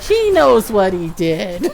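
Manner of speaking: slow, loud, pointed mock indignation; playful cold-hearted verdict; mock grudge. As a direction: draw it out with sassy finality. Mock coldness about picked-off character.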